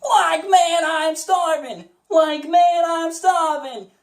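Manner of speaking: high voice, touch of country accent; Shaggy with country accent. Shown as a demonstration. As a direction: add a little country drawl up high. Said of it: "a tiny bit of country into it".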